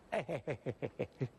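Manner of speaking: low forced chuckle; awkward laugh. Acted. Shoemaker laughs it off to Wang Ping, low forced chuckle. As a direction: cover the moment with a fake laugh.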